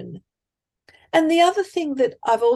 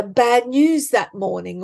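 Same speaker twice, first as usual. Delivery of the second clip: normal talking voice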